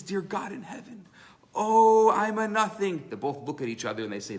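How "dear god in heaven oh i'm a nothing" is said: earnest prayer. Retold or imitated. Simple guy prays with eyes closed.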